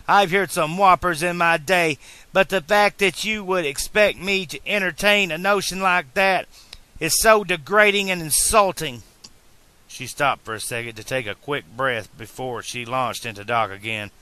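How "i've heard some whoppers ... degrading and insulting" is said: loud, high, angry scolding; rising anger. A narrator's reading. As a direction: let the fury build at him. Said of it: "the anger rising in her voice".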